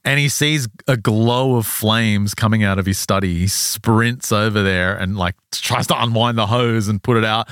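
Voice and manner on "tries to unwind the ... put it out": usual speaking voice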